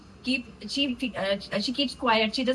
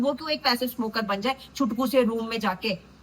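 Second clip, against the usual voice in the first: normal talking voice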